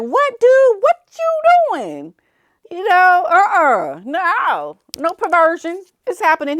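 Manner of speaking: high, startled mock yell; shocked outburst.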